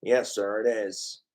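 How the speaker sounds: low obedient assistant voice; Smithers. Acted. Smithers confirms to his boss, low and dutiful. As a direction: confirm dutifully, pitched well down. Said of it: "My Simpsons voices".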